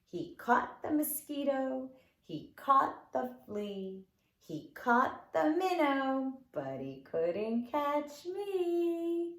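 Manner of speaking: lilting sing-song rhyme voice; fingerplay rhyme for kids; children's rhyme recitation. Acted. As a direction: recite it playfully for toddlers.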